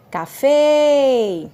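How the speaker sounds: "café" with a long, loud, held "fé"; calling out. Shown as a demonstration. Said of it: "chamá-la em tom mais alto".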